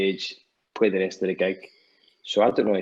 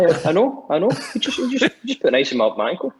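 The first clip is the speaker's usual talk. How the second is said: high, louder mock-flustered stammer; hammed-up star-struck babble; starstruck reply; 9 semitones higher, 6 dB louder, faster than usual. Retold own starstruck reply.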